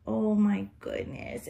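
ordinary delivery

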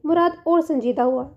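ordinary delivery, nothing marked

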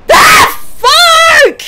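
high shriek, then a cursing cry; startled scream. Real, not acted. Player scared by the game, startled high scream. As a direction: shriek in fright and swear.

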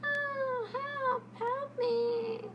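high, whiny plea for help. Shown as a demonstration. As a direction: whine it like a helpless child. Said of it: "Should she use a whiny voice?".

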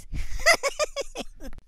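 shrill canned-style cackle after the punchline; high cackle. Real, not acted. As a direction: burst into a high cackling laugh. Host laughs at own joke.

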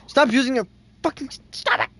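high, loud furious yell; angry yell. Real, not acted. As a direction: shout at him to stop. Yelling at friend.

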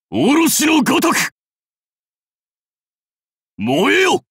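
high, forceful warlord shouts; battle cries. Acted. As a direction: call out commands across a battlefield. (武将の鬨の声、高く力強い叫び)

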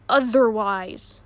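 drawn-out threat to a daughter; slow warning. Acted. Father warns Cornelia before the party, slow and ominous. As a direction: let the word hang as a threat.

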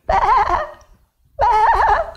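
high, loud pitiful bleating; lost lamb crying for help; sheep bleat. Acted.

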